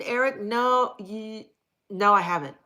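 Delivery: usual speaking voice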